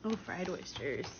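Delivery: ordinary talk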